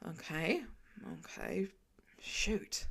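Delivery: hushed, rising okays under the breath; panicked whisper. A narrator's reading. Tony whispering to himself in sudden panic.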